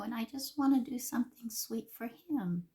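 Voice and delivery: usual speaking voice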